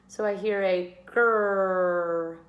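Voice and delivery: "grrr" growled out slowly; teacher sounding out a blend. Shown as a demonstration. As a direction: stretch the "grrr" as a long growl. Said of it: "Ooh, that's two sounds".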